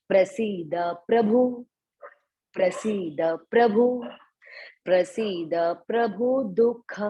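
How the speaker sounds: slow, each word set apart with pauses; words broken apart. Shown as a demonstration. Teacher breaking words so students catch pronunciation. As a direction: break प्रसीद प्रभु into separate words. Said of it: "words को break break करके बोल रही हूँ".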